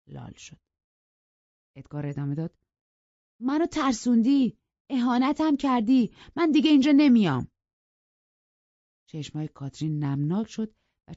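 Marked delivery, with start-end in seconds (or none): 3.4-7.5 high, loud, wounded protest; offended accusation. Acted. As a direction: build to a rushed, loud final refusal. Offended suitor accuses her in quarrel.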